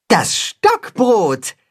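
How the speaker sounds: slow, high-pitched announcement; calling out. A narrator's reading. The cat hen names the missing item, calling out. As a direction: call it out high and drawn out. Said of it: "ruft das Katzenhuhn".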